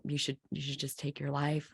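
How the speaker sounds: hushed, low retelling of a dark thought; quiet, sinking inner voice. Retold or imitated. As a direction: let it drop low and soft.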